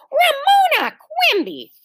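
high, slow, drawn-out full-name scolding; exasperated. A narrator's reading. Mother reacts to Ramona's confession, exasperated. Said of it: "Even Mother sounded exasperated".

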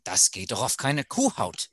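the German saying spoken in German. Shown as a demonstration. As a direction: give the line in native German. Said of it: "I'll say it in German".

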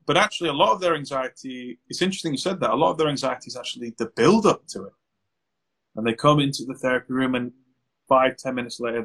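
ordinary delivery

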